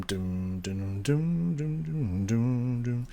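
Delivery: soft doom doom beating out the rhythm; rhythm imitation. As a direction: tap out the guitar rhythm with your voice. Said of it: "the change in the rhythm".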